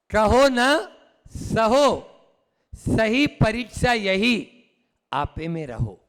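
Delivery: measured verse with deliberate pauses, falling at the close; haiku recitation. Shown as a demonstration. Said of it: "haiku है".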